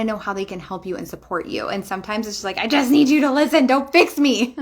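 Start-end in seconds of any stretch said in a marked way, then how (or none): 2.6-4.6 loud, higher, quick burst of frustration; exasperated plea. Retold or imitated. Wife telling husband to stop fixing, loud exasperated plea. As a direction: blurt it out, fed up and pleading.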